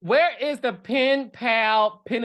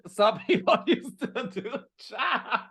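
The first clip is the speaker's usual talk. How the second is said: laughing; 7 semitones higher, slower than usual. Real, not acted.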